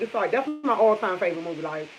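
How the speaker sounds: ordinary talk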